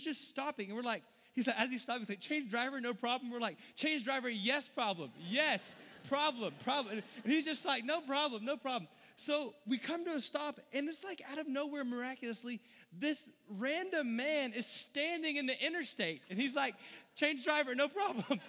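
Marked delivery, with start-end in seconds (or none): 2.2-3.3 cheery driver. Retold or imitated. Retelling the driver's catchphrase. 3.8-7.0 mock protest. Passengers mocking the driver's phrase. 7.8-8.9 breezy driver. Retold or imitated. Driver brushing off the protest. 17.2-18.4 cheery new driver. Retold or imitated.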